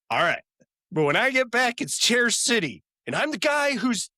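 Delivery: gloating Chandler. Retold or imitated. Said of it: "Chandler goes".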